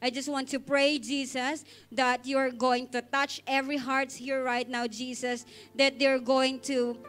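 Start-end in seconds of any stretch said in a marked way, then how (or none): none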